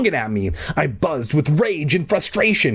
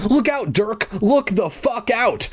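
high, drawn-out urgent cry; squeaked warning; 10 semitones higher than usual. A narrator's reading. Tiny bee warning the boy in danger, squeaking. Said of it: "I squeaked".

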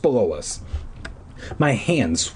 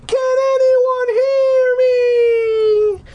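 frightened scream; 6 dB louder than usual. A narrator's reading. Eddie, calling down the empty tower, screaming in fear. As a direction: scream down the stairwell in panic. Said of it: "His shrill voice echoed".

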